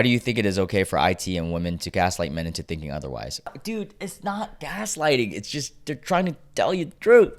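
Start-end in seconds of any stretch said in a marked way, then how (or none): 3.6-7.3 high put-on exasperated voice; exasperated reply. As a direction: act out a high-pitched protest.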